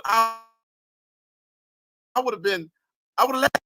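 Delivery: ordinary talk, nothing marked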